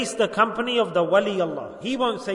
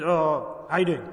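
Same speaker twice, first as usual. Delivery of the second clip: offhand, dismissive mumbled greeting to someone disliked; cold greeting; 5 semitones lower than usual. Retold or imitated. Preacher mimics a cold greeting to a disliked person, offhand. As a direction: brush him off with a grudging hello. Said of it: "what's the point of saying salam to him".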